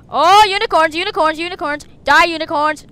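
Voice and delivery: loud, excited chanting; excited battle cry. Real, not acted. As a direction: cheer on your own attack. Battle cries in game fight.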